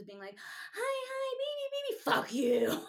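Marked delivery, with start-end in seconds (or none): 0.7-2.0 put-on very high, babyish pleading; high sing-song sweet talk, mock-needy; cutesy texting voice. Voicing own sappy texts. 2.0-2.8 louder mock curse; sudden drop from cutesy into a loud swear; mock angry outburst. Texts flip to abuse.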